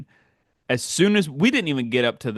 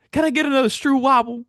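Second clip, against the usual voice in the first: mock eager old lady; 11 semitones higher, 3 dB louder than usual. Seatmate asking for more.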